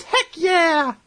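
put-on high squeaky kid cheer. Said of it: "in a squeaky voice".

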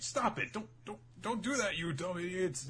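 high, rapid flustered scold; hammed-up high exasperated yelp; frantic scolding. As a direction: scramble to stop them. Sai scolding.

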